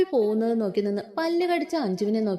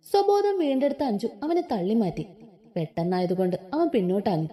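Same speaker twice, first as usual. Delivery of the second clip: usual speaking voice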